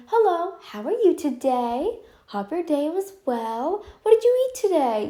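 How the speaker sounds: high, sugary fake-polite greeting, slowed a little; mock sweet small talk. Mocking the polite small talk they won't share, high and sugary. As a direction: put on an overly friendly chirpy voice. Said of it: "It's not like it's gonna be".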